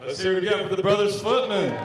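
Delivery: rally the room for the band; hyped emcee call-out. Real, not acted.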